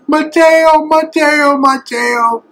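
high, loud excited calling of a name; a ringing children's shout; girls shouting his name. Retold or imitated. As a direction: call out eagerly like children running to greet him.

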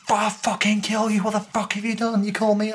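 high-pitched angry mock yell; heated threats without full volume; retold shouting. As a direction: act out an angry crowd, pitched right up. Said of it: "People were shouting".

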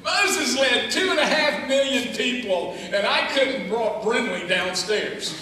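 loud preacher's cry; preaching shout. Acted. Preaching about Moses.

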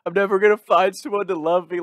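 high, loud hammed-up whine; over-the-top self-pity, wailed high; mock crying. Imitating himself crying to his friend, high loud whine. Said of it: "I'm crying like".

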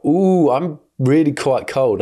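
slow, lofty mock ice queen. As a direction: play the cold ice queen with a drawn-out ooh. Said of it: "I'm an ice queen".

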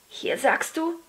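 ordinary delivery, nothing marked

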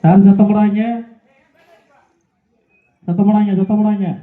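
loud, low-pitched order to the crowd; low, forceful call; calling for silence. Real, not acted. Hushing spectators during the game.

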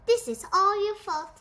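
high, loud, fast accusation; angry. A narrator's reading. Angry cat blaming the rat, loud outburst. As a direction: fling the blame out loudly. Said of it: "The cat was very angry".

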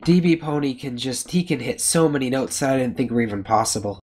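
ordinary delivery

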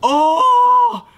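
high, slow disgusted whine; grossed out. Real, not acted. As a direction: squeal at the smell. Disgusted by sardine smell.

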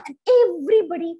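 ordinary delivery, nothing marked

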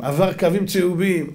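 ordinary delivery

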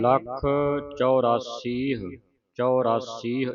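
ਲੱਖ and ਚੁਰਾਸੀਹ split apart, ਚੁਰਾਸੀਹ slow with a clear "chu-raa-seeh"; model pronunciation. Shown as a demonstration. Teacher shows the student how ਚੁਰਾਸੀਹ is said, slowly and clearly. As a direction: say ਚੁਰਾਸੀਹ carefully, syllable by syllable, with a clear u. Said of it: "ਇਸ ਤਰ੍ਹਾਂ ਉਚਾਰਨ ਕਰਨਾ".

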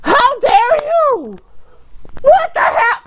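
very high, wailing disbelief; outraged protest. Real, not acted. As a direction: cry out high as if personally betrayed. Protesting the video's sudden ending.

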